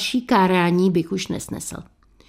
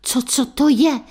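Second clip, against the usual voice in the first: stammered, terrified question; stutter, then squeak it higher; frightened gasping outburst; startled yelp; 5 semitones higher than usual. A narrator's reading. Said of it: "vyjekl Pavel".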